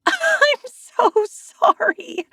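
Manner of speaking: high, slow "I'm so sorry" through laughter; laughing shriek. A narrator's reading. Narrator apologizing to soaked Zane while laughing helplessly. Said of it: "I shriek, unable to stop laughing".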